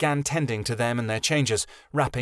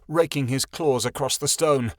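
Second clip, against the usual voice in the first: usual speaking voice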